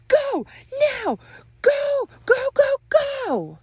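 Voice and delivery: very high, loud shouted commands; piercingly high, urgent yells; frantic yelling. Acted. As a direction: shout each word like chasing someone off. Chasing an intruder away.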